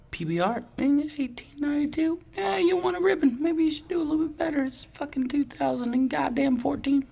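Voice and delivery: high-pitched mocking rant; mock the old boast, high and fast. Mocking beer brand's boast.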